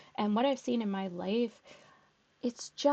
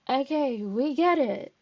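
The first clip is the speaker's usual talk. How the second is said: exasperated mock; 4 semitones higher, 6 dB louder than usual. Exasperated at Amber's martyr act.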